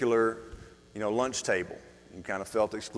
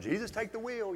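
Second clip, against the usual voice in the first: high-pitched mock plea, much higher; mock desperate prayer; 11 semitones higher, 7 dB quieter than usual. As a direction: toss it up like a joking panicked prayer. Mock prayer while driving.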